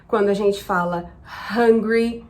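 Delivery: normal talking voice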